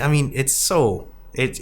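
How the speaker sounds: ordinary talk, nothing marked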